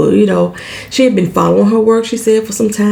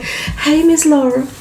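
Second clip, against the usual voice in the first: higher, drawn out Miss, lightly voiced Southern greeting; a hint of a Southern belle calling hello; Southern polite greetings; 8 semitones higher, slower than usual. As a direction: sweetly greet each lady, stretching Miss. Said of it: "I love to hear it on T V".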